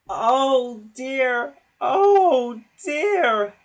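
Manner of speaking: high, loud moaning lament; groaning. A narrator's reading. The trucks groaning in a heap. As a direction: groan it as a sorry chorus. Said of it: "they groaned".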